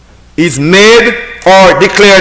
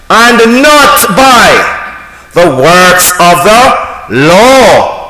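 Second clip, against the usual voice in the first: slow emphasis; much slower than usual. Acted.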